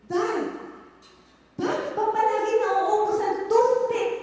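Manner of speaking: high, loud exasperated yell; yelling at the maid. Retold or imitated. Neighbor scolding her maid over toothpicks, yelling. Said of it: "yelling at her maid".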